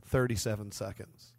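low, hushed aside, still voiced; retold whisper. Bar-goer in a girl's ear, mock whisper, low and soft. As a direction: drop it low and soft, as if confiding. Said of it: "I whisper in her ear".

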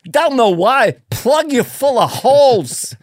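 high, loud, bending threats; halting oddball killer, projected; Christopher Walken hitman. Acted. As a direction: menace with odd stretched emphasis. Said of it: "You should play a hitman that talks like that".

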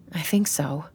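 softly whispered answer; just a breath of voice. Acted. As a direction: Carrie whispering she's okay. Hushed after the fight.

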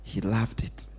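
soft, slow and low, almost confiding; quiet intimate preaching; hushed emphasis. Real, not acted. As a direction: drop to a near hush to let it sink in. Preacher hushes on key point.

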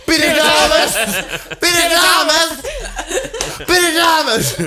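high-pitched holler for someone in a club; playful yell. Pretend. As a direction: yell the name out for laughs. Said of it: "the only thing I wanna yell".

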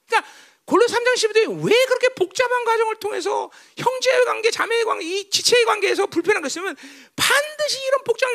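high-pitched, insistent sermon delivery; forceful preaching. Real, not acted. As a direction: drive the point home to the congregation.

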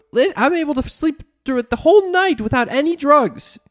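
high, excited mimicry of mom; mother imitation. Daughter retells mom's morning phone call, high-pitched imitation. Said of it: "you called me this morning. You go".

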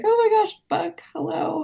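high-pitched starstruck greeting; fangirling. Retold or imitated. Guest imitates herself greeting Buck by phone, fangirling. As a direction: gush like a fan, re-enacted. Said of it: "I did fangirl Buck Plank".

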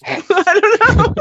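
high, laughing words. Real, not acted. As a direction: laugh it out loud. Laughing at own joke.

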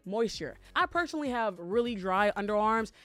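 upbeat podcast ad read; sponsor ad read. Acted. Host pitches deodorant to listeners, sponsor ad read. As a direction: sell it to listeners, emphatic and measured.

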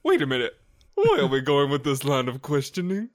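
loud drag-queen impression; Alyssa Edwards. Showing a friend the resemblance, loud theatrical impression. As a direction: challenge it with theatrical indignation. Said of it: "doesn't it sound like Alyssa Edwards".

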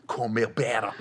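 low, quiet rapper mutter. Acted. Comic repeating his hook, low rapper impression. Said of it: "did that sound like E forty".

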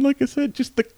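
ordinary delivery

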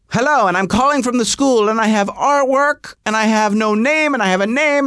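high-pitched phone caller voice; caller from school. Acted. Caller phoning from the school about artwork, high put-on voice.

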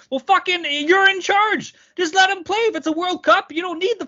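high-pitched, indignant protest; exasperated outburst. Real, not acted. Podcaster telling the league boss he's in charge, exasperated. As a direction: argue it in real exasperation, voice way up.

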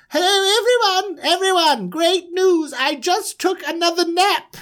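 high, singsong announcement; self-important manager. Acted. Manager announces another nap to everyone, high and excited. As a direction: trumpet your news brightly, way up in pitch. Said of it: "here he comes again".

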